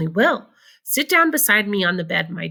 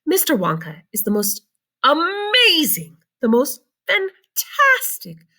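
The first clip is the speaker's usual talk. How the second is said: excited grandpa; 5 semitones higher than usual. A narrator's reading. Grandpa Joe praising Mr Wonka to Charlie, rising excitement. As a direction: marvel at every word. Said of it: "said Grandpa Joe".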